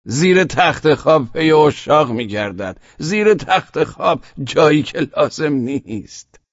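put-on high, excited child's voice, loud; child eagerly blurts it again. A narrator's reading. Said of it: "با هیجان تکرار کرد".

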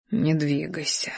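hushed, strained restraint; soft breathy warning; breathing fast. A narrator's reading. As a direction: plead very softly, holding back. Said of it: "учащённо дышал я".

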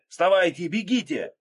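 loud, high, urgent yell; cracking hoarse shout. A narrator's reading. Narrator urging companions to run, strained shout. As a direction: urge them up with a strained cry. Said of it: "сорвавшимся голосом хрипел я".

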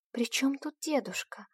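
scared, hushed question; frightened whisper. A narrator's reading. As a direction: whisper it in fear. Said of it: "испуганно спросила Альба".

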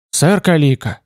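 ordinary delivery, nothing marked

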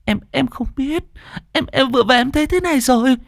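high-pitched, stammering panic; horrified. A narrator's reading. Scared roommate explains to the neighbour, high panicked stammer. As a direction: gabble it out in tearful-sounding fright. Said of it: "Hằng kinh hãi".

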